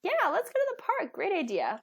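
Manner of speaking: yeah leaps very high, eager; enthusiastic yes. Retold or imitated.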